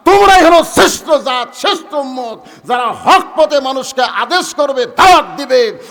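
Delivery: loud, high-pitched fiery sermon; full-force preaching. Acted. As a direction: preach it out forcefully to a big crowd.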